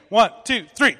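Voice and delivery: loud counting in; shouted count. Real, not acted. Leader counting the kids in, shouting.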